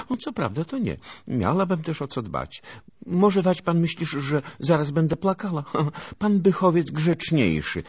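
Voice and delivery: put-on high, pert girl's voice; teasing girl.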